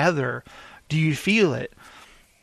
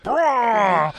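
a mock Wookiee roar, pitched up; Chewbacca roar; 5 semitones higher than usual. Podcaster mimics Chewbacca about to eat the porg, mock roar. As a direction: growl it like a hungry Chewie. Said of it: "and he's like".

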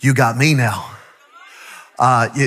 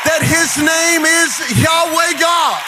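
raised, shouting preacher's climax; full-force preaching; 8 semitones higher than usual. Acted. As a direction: build the room up and shout it out.

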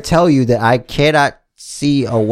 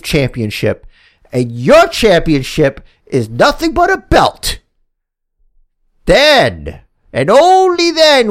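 wrestling promo. Pretend. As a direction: preach your own victory like a champion. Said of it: "That was the worst promo I ever cut".

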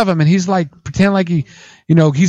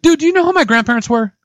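high-pitched, incredulous retort; affronted, high-voiced challenge; indignant comeback; 9 semitones higher than usual. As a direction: protest in disbelief, voice pitched well up. Acted comeback to racism accusation.

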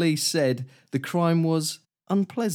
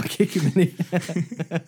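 laughing through quote; 5 semitones higher, slower than usual. Real, not acted. Quoting angry man, laughing.

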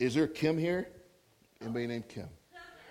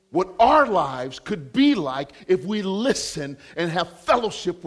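loud, raised preacher's voice driving the point home; impassioned preaching; 5 semitones higher, 8 dB louder than usual. Real, not acted. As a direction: preach it with rising force, louder and higher. Preaches with rising force.

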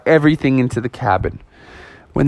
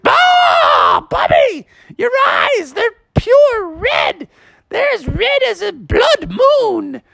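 very high shrieking alarm; alarmed mom shout; slower than usual. Acted. Mom seeing Bubby's red eyes, high alarmed shout. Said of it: "she went".

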